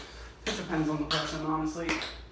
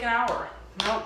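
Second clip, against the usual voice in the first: usual speaking voice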